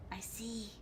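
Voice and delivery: breathy secret aside, no voice; menacing whisper. Acted. Villain whispering to a hiding survivor.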